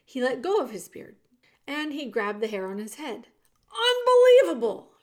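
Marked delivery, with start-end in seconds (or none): 3.9-4.9 high, very loud exclamation; outraged shout. A narrator's reading. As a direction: shout it in sheer disbelief. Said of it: "he shouted".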